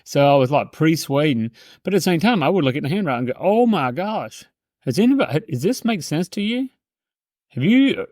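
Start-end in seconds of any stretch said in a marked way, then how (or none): 3.4-8.1 mock astonishment. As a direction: put on wonder to hook the customer. Acting out reading a signature.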